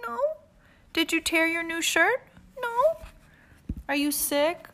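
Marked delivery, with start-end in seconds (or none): 2.6-3.0 very high small voice; small child voice. Acted. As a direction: answer like a sulky toddler. Pookie answers.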